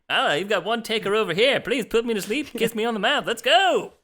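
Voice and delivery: hammy vintage radio pitchman; 1950s narrator. Acted. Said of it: "Sound like a, like a newsreel dude from the nineteen twenties".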